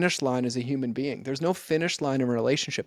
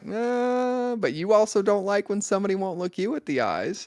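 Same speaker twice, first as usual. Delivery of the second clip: teasing retort; 7 semitones higher, 3 dB louder than usual. Retold or imitated. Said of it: "And I'm like".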